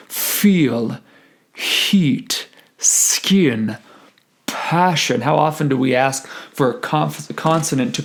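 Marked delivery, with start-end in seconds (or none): 0.1-5.2 "feel", "heat", "skin", "passion" one by one, opening consonants exaggerated; emphasized consonants. Shown as a demonstration. Choir teacher showing which consonants to focus on, over-articulated. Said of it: "consonants ... I especially want them to focus on".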